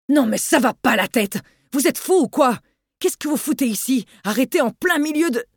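high, indignant yelling at the driver; angry outburst. Acted. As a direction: tear into him, outraged. Driver yells at stunned man.

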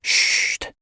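voiceless, soothing shush; consoling hush. A narrator's reading. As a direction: hush someone gently on the breath. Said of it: "souffla-t-il en la consolant".

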